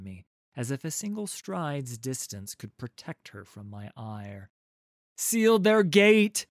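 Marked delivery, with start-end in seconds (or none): 5.2-6.5 very loud, high, drawn-out shout; louder barked command. A narrator's reading. Warrior repeating an order as if unheard, louder barked command. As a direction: bark the order at full volume. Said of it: "barking louder".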